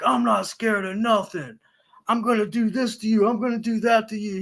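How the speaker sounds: a lightly voiced boastful tough guy, pitch up; mock tough talk. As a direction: brag like an online tough guy. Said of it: "he's like".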